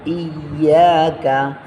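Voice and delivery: ইয়াকা slowly, long yeah held; one-alif stretch. Shown as a demonstration. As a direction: draw out yeah before a short কা. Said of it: "এক আলিফ টানিয়ে পড়তে হবে এই জায়গায়". (ইয়াকা এক আলিফ টেনে পড়া)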